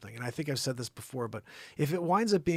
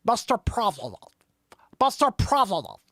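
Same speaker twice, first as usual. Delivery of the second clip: high, loud news-moderator impression; put-on moderator voice, raised and insistent; Chris Wallace; 7 semitones higher, 6 dB louder than usual. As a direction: call out like an interrupting debate moderator. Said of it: "I'm gonna do Chris Wallace".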